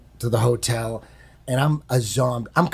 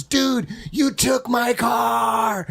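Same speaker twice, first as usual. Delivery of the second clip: higher, stretched-out mock shout; mock angry yell; 7 semitones higher, much slower than usual. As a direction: imitate an exasperated friend wailing the accusation slowly. Angry friend calls again, acted out.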